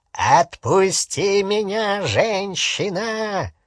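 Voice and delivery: high, slow, strained growl; drawn-out beastly demand; snarling werewolf. A narrator's reading. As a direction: snarl for release through a frozen body. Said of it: "зарычал он в ответ".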